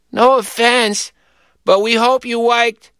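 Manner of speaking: put-on high, slow child voice; halting small-child announcement; queasy little boy. A narrator's reading. Sick little boy announcing intermission, high and slow.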